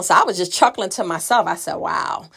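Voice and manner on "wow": breathy, amused whisper under the breath; soft disbelieving whisper; whispered wow. Retold or imitated. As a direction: whisper it to yourself, stunned and amused. Chuckling at stepmother on phone.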